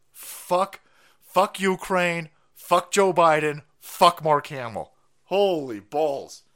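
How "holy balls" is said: ordinary talk, nothing marked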